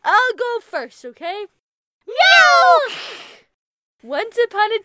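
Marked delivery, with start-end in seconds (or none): 2.1-2.8 sudden high loud shout. Acted. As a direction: yell it out high. Sudden outburst interrupting.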